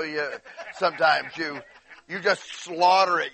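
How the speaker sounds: ordinary delivery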